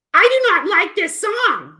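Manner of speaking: high, loud, slow kitty complaint; puppet cat voice. Acted. Cat puppet complains about the song, high and slow. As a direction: whine it as the offended cat.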